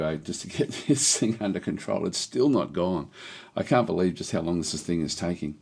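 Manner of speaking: normal talking voice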